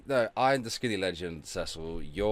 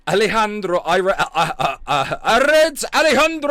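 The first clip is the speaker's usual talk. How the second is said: showy shout; 12 semitones higher, 12 dB louder than usual. Pretend. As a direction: belt out the name with mock Spanish flourish. Shouts name with showy Spanish flourish.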